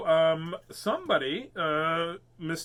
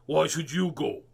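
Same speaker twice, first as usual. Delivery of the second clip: cross. Acted.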